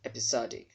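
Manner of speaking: "episodic" with short open O, "ep-i-SOD-ik"; correct pronunciation. Shown as a demonstration. Teacher confirming the stressed vowel for learners, correct pronunciation. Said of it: "there will sound as O".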